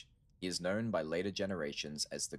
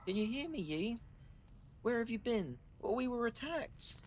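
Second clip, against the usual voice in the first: much higher put-on girl's voice; high girlish voice. Shun Shun relieved Yi is awake, high girlish voice. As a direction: lift way up into a young girl's voice. Shun Shun calling through danger.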